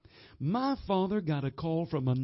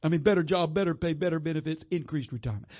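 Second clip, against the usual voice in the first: usual speaking voice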